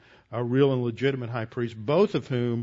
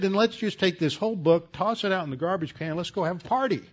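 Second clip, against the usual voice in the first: higher, pushing sermon voice; fervent preaching; 4 semitones higher than usual. Acted. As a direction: preach it with rising conviction. Rising preaching about exclusivity.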